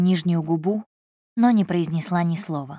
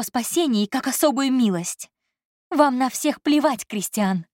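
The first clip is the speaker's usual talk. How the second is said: put-on higher, accusing young woman; Elsa's voice; 7 semitones higher than usual. Secretary accusing her boss, higher and accusing. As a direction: hurl the accusation, voice lifted.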